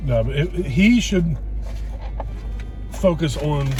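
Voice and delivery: ordinary delivery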